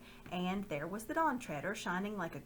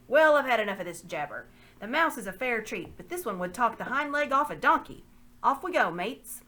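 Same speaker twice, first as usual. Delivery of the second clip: much louder gruff outburst; rough loud dismissal; 4 semitones higher, 10 dB louder than usual. Acted. The slaver ends the talk with his men, in a loud rough bark. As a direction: cut the chatter off and order them out.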